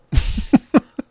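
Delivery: short airy giggle; quick breathy chuckle; breathy laugh. Real, not acted. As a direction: laugh at the nice comment. Pleased people know his interests.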